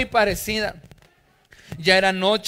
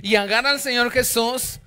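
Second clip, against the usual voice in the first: usual speaking voice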